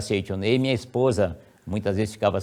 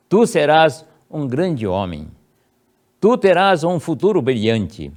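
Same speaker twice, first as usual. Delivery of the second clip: prayer read aloud; 5 semitones higher, 5 dB louder than usual. Shown as a demonstration. Parent praying over a child at bedside, solemn blessing. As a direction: bless the sleeping son with each phrase. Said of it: "ela fazia a seguinte oração".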